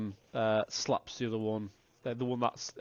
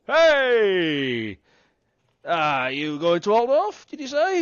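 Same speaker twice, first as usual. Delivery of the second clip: loud, higher boozy greeting; drunk shout; 8 semitones higher, 11 dB louder, slower than usual. Acted. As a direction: bellow it like a happy drunk. Drunk man hails the traveller.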